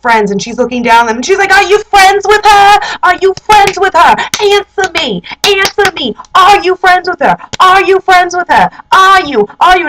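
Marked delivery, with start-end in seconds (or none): 1.5-9.9 high-pitched, hammed-up nagging demand; flipping out. Jealous girlfriend yelling off the balcony, mock flip-out. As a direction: imitate a frantic interrogation, pitch held high. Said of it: "flips out".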